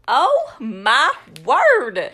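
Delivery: loud, slow, each word separate; theatrically fed-up wife; mock exasperation. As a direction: draw out every word in exasperation. Acted exasperation at husband's question.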